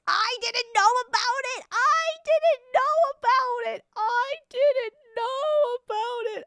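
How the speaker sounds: high, loud wail of protest; shrill-pitched exasperated outburst; shrieked denial. Real, not acted. Loudly denying knowledge of map.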